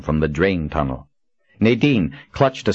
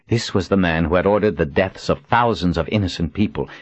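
plain reading voice

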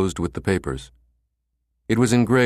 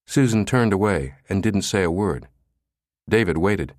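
usual speaking voice

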